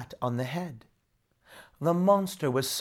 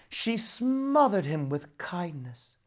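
plain reading voice

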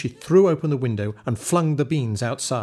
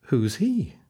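put-on curious boy; 4 semitones higher than usual. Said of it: "asked Jack".

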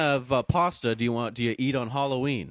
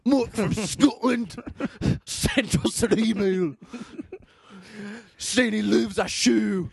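slow, higher hammed-up Scottish accent; mock Scottish accent; 7 semitones higher, slower than usual. Hosts retelling a fan email in a mock Scottish accent. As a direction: play the Scottish fan Mike, half-joking. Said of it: "That was more Scottish".

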